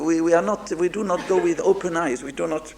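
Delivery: usual speaking voice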